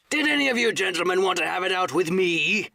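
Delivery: high, loud challenge; roar. A narrator's reading. Silver challenging the mutinous gentlemen, full roar. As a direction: roar it out, daring all comers. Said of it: "roared Silver".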